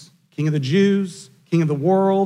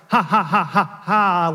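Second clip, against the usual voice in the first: mock comic book villain cackle; lightly hammed-up evil laugh; crazed villain laugh. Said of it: "like some crazed comic book villain".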